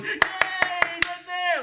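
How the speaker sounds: high, drawn-out mock cheer; sarcastic high-pitched hooray. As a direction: imitate gushing fans with a long squeal. Mimics feminists cheering.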